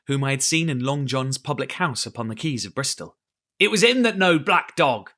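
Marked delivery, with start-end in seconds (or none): 3.6-5.1 higher, louder pirate accusation; angry. A narrator's reading. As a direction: spit the accusation out.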